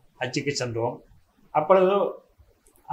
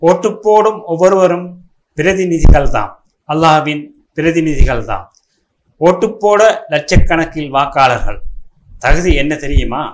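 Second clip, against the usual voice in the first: emphatic, punched verse delivery; forceful poem declamation; 8 dB louder than usual. Acted. Poet proclaiming who the voters are, forceful declamation. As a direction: proclaim each line to the crowd.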